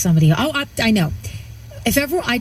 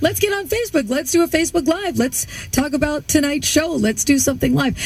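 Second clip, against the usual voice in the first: excited; 6 semitones higher than usual. Retold or imitated. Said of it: "we were just like".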